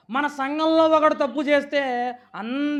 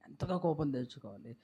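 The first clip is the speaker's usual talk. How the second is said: hushed repeat; 9 semitones lower, 11 dB quieter than usual. Real, not acted. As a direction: say ఎంతగా కోపం తెచ్చుకోవాలి low and much softer. (ఎంతగా కోపం తెచ్చుకోవాలి అని లోతుగా, మెల్లగా)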